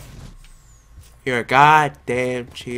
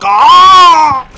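loud high cry of frustration; pained yell; 12 semitones higher, 13 dB louder than usual. Real, not acted. Gamer getting killed mid-match, frustrated yell. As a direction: yelp as you get killed.

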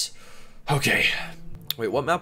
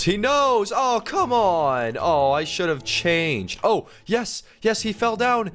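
frantic excited yelling; 11 semitones higher than usual. Real, not acted. Caught player shouts at the seeker who found him. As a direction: yell in alarm, then cheer.

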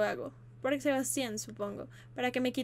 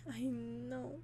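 ordinary talk, nothing marked

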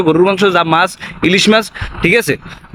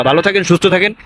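ordinary talk

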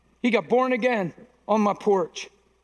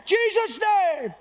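very high, drawn-out mock holler; hammed-up prayer cry; 12 semitones higher, much slower than usual. Preacher mocking a showy prayer over a shy man, hollering. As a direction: wail it out slowly for comic effect. Comic exaggerated second take of prayer.

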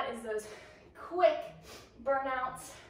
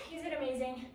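ordinary delivery

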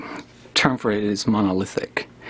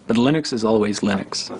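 ordinary talk

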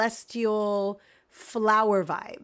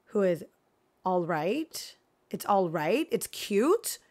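normal talking voice

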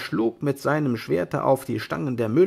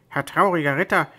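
blurting out; 4 semitones higher, 4 dB louder, faster than usual. Acted. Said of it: "platzte Sancho Panza heraus".

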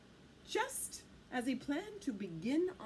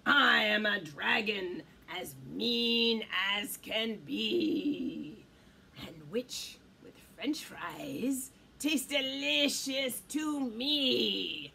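low, drawn-out dragon voice; mean dragon; 6 semitones lower, slower than usual. A narrator's reading. Dragon tells witch she would taste delicious, low menace. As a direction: play a menacing storybook dragon, pitched down.

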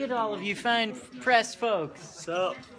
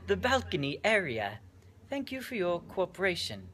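softer, mock-official British accent; 3 semitones lower, 7 dB quieter than usual. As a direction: finish the notice in pretend British. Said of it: "The best British accent you've ever heard".

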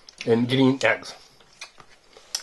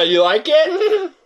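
laughing question, higher and slow; 10 semitones higher, much slower than usual. Real, not acted. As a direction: ask while giggling. Laughing at assistant.

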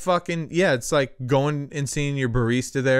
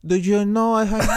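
higher, hammed-up Mexican-flavored accent; mock a far-south accent, pitched up; 6 semitones higher, 4 dB louder than usual. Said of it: "Like, way south".

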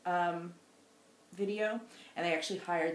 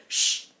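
imitating shush; 9 dB louder than usual. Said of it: "Peter answered the door and said".

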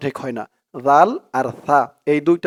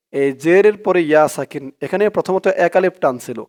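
ordinary delivery

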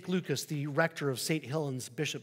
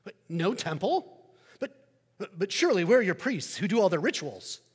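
higher, louder, chatty skeptical neighbor; Roman neighbor; 4 semitones higher, 7 dB louder than usual. Acted. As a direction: play the curious pagan neighbor, bright and pushy. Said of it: "the neighbor says".

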